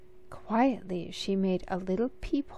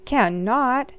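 sister shouts him down, louder; sibling squabble, a firm louder Cannot; crying out, louder; 4 semitones higher, 6 dB louder than usual. Acted. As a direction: insist hard, louder. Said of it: "she cried".

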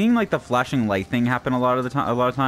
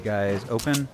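ordinary talk

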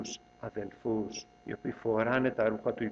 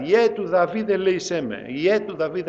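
high, loud plea for mercy; a beggar shouting for mercy; crying out; 6 semitones higher, 8 dB louder than usual. A narrator's reading. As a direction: call out desperately to be heard. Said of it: "αρχίζει να κραυγάζει".

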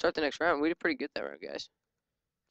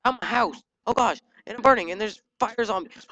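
alarmed outburst; 5 semitones higher, 6 dB louder than usual. Real, not acted. Burning again in the game.

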